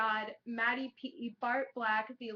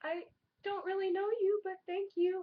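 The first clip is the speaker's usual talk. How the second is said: puzzled; 10 semitones higher, 4 dB louder than usual. Retold or imitated. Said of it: "I'm like".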